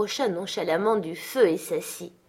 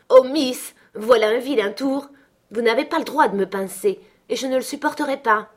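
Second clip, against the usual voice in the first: higher, louder protest; indignant cry; 5 semitones higher, 5 dB louder than usual. A narrator's reading. Maid protests at being pinched by girl, crying out. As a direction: exclaim in pained outrage. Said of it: "et je m'écriai".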